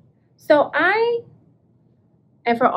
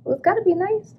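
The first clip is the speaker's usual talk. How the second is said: higher, knowing little voice; impressed; 7 semitones higher than usual. Retold or imitated. Reading the label to herself, impressed. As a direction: say it like it must be nice. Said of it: "I'm like".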